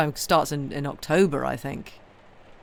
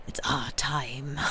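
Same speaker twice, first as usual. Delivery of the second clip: whisper; much slower than usual. Pretend. Cozy season, mock secret.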